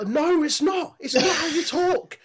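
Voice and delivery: high exasperated protest. Retold or imitated. Shouting at Cruz to stop faking the accent, high and loud. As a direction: plead with him to stop, squeaky and exasperated. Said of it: "I go".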